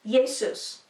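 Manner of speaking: Jesus said YAY-soos; Y for the J, oo at the end of Jesus; old Latin pronunciation. Shown as a demonstration. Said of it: "how it was spoken".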